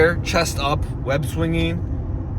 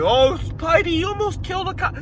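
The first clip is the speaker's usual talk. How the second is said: mocking whiny voice; 13 semitones higher than usual. Mocking the cops' line.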